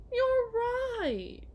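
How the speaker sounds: high, mocking you're right; sarcastic agreement. To the partner, sarcastic high agreement. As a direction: toss it back with mock agreement.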